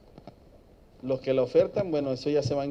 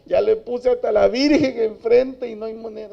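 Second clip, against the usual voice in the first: higher and louder, pleading in despair; desperate mother; 8 semitones higher, 9 dB louder than usual. Retold or imitated. Mother despairing over her absent son, higher desperate plea. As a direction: plead as a worn-out mother who tried everything. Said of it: "Y desesperada".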